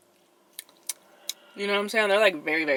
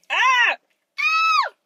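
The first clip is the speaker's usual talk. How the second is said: two loud, high shrieks; repeated screams; 8 dB louder than usual. Real, not acted. Vlogger overwhelmed by gummy sweetness, loud high shrieks. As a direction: squeal out your disgust, higher each time. Cannot stand the sweet taste.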